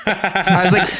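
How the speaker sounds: laughs through the words; laughing. Real, not acted. Telling friends the story, laughing. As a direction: let the laugh break in.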